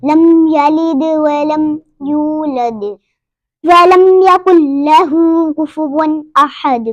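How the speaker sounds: slow, measured verses with held long vowels; Quran recitation. Shown as a demonstration. Teacher recites verses for learners, measured recitation. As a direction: recite deliberately, bouncing the final D.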